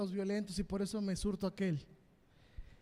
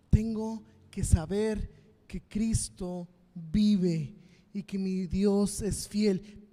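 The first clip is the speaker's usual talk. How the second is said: slow, louder proclamation; fervent declaration; 5 dB louder, much slower than usual. Real, not acted. Preacher leading the church, slow loud declaration. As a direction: declare each word slowly.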